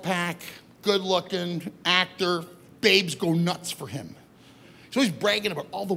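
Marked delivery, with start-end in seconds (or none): none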